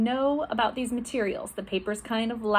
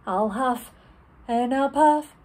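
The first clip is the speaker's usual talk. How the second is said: starts plain, then builds to loud and higher on blow it down; huff and puff, building; 3 semitones higher, 4 dB louder than usual. Pretend. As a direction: build each phrase up like a huffing wolf. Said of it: "should we try the cups?".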